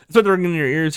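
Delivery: plain reading voice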